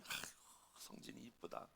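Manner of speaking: hushed, whispery voice of a kind teacher; softly dote on the child, nearly whispering; tender praise. Retold or imitated. Old teacher praises a neglected boy, tender whisper. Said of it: "참 따뜻하다".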